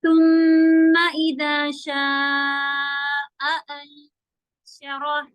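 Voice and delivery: drawn-out chanted recitation; slow Quran recitation. Shown as a demonstration. Teacher reciting the verse for students, slow chant. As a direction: chant each syllable, very slow. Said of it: "आपने हमजा की sound सुनी हो".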